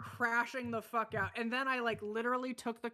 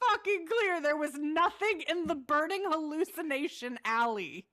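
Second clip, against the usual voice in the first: higher, louder defensive protest; indignant protest; 7 semitones higher, 4 dB louder than usual. Real, not acted. Gamer protesting to her partner about the loot. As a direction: push back, outraged.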